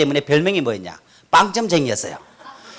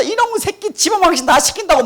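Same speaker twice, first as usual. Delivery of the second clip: mock angry mother; 9 semitones higher than usual. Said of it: "그걸 때리면서".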